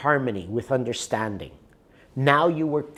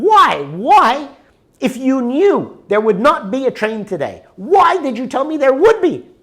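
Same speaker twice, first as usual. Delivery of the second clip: higher and louder, furious questioning; angry demand; 10 semitones higher, 8 dB louder than usual. Retold or imitated. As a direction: demand an answer, really angry. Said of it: "now I'm getting really angry".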